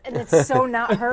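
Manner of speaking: usual speaking voice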